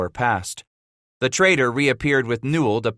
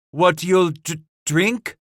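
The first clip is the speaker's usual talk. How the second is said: high stammering question; flustered high-pitched stammer; 9 semitones higher, slower than usual. A narrator's reading. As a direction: trip over it in disbelief. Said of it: "Robidoux flustered".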